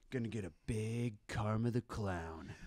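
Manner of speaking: deadpan low dad; low quiet dad voice. Retold or imitated. Dad announces clown tattoo.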